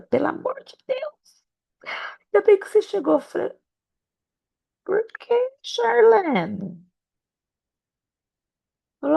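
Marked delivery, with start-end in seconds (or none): 0.0-6.8 much higher, panicky pleading voice; high flustered church ladies; frantic pastors. Retold or imitated. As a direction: play the desperate pastora begging. Frantic pastors beg her.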